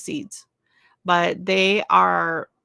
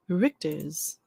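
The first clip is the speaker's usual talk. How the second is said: Richter's with a German accent, rolled and careful; Richter's in a light put-on German accent; 2 semitones lower than usual. Shown as a demonstration. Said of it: "I don't know why I have to have the accent".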